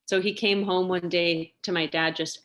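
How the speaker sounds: ordinary delivery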